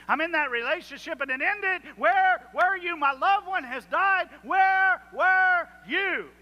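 rising to a slow, loud, high stretched cry; hurting believer calling out to God, building to a cry; building anguished plea. Acted. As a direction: climb from telling to crying out. Preacher voicing hurting believers' cries.

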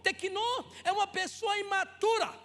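loud, driving sermon delivery; fervent preaching. Acted. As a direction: preach it out to the whole church. Preaching at full force. (pregação fervorosa e alta)

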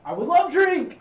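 high, loud eager outburst; hammed-up delight; overexcited acceptance. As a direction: accept with far too much enthusiasm. Eagerly accepts barkeep's offer.

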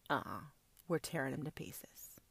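menacing whisper; whispered threat. Acted. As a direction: hiss the threat under breath.

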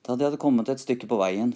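plain reading voice

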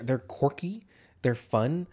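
ordinary talk, nothing marked

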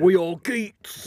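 put-on slow London East End accent. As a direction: quote it in a slow Cockney growl. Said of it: "there you've got the East End accent".

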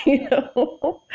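high giggle running into the words; real laugh, voice lifted high. Real, not acted. Joking about the narrator's odd pronunciation, laughing.

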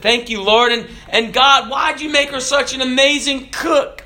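high-pitched, eager prayer in a joke; husband questioning God. Acted. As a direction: ask God in lightly acted amazement, pitch way up. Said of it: "And the man said".

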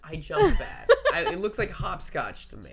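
loud, high laughing confession; laughing, near tears of mirth. Real, not acted. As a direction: laugh through the words. Laughing at own jumping.

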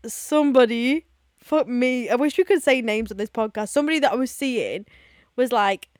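ordinary delivery

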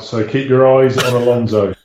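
deep muffled mic voice; booming low through a bad mic. Real, not acted.

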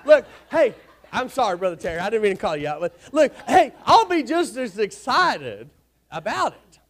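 loud, playful bragging to a friend; fired-up ribbing. Real, not acted. Preacher teasing Brother Terry about football, loud and playful. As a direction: rib him about the game.

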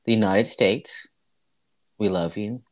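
ordinary talk, nothing marked

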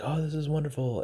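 hammed-up joy over a deal; mock delight. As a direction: gush like it's the best news ever. Mock elated about a deal.

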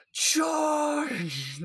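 high, shouted battle cry; rally the troops with a yell. Retold or imitated. Battle cry in retold fight.

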